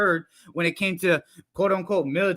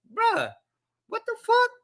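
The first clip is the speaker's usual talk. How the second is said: shocked disbelief. Real, not acted. Vlogger stunned by the confession, high shocked outburst. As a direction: pitch jumps way up in stunned disbelief. Outraged reaction to the story.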